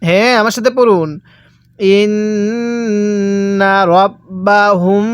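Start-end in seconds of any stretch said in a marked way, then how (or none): 2.0-5.1 "ইন্না রব্বাহুম" recited slowly with long nasal hums on nn and final m; ghunnah. Shown as a demonstration. Teacher showing how to read it, "ইন্না রব্বাহুম" with sustained ghunnah. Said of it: "আমি যেখানে গুনাহ করব".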